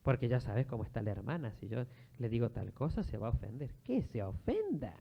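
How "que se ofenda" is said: high, drawn-out so-what; dismissive retort. Acted. Preacher answering fear of offending a sister, mock dismissal. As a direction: toss it off high and slow.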